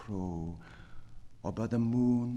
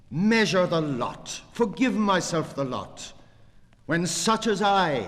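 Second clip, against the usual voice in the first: loud and high, near shouting; ecstatic declaiming; 6 semitones higher, 7 dB louder than usual. Acted. Poet reciting his verse, declaiming loud and exultant. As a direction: fling each line out in triumph. Ecstatic climax of poem reading.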